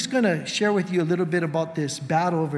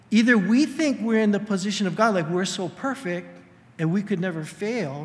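normal talking voice

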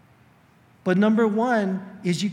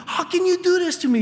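high, loud and faster wounded repeat; fast hurt accusation; 11 semitones higher, 4 dB louder, faster than usual. Retold or imitated. A spouse repeating the accusation to their partner, fast high outcry. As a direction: press the accusation harder and quicker. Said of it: "you've been in those arguments".

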